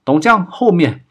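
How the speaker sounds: high-pitched urgent warning cry; shouted warning. A narrator's reading. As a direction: call out a sudden alarm, pitched high. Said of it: "金丹丽见状大叫道".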